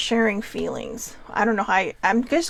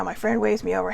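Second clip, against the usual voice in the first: ordinary delivery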